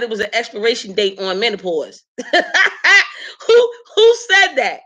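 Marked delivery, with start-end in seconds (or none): 2.2-3.4 high burst of real laughter. Real, not acted. To her audience, laughing at an expiration date. 3.5-4.8 high, loud, laughing question; laughing disbelief. Real, not acted. To her listeners, demanding who said it, laughing.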